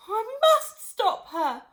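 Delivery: high, loud, slow wail. Acted. Missus Cranky wailing over her tiny mother. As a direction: wail in despair. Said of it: "Missus Cranky wailed".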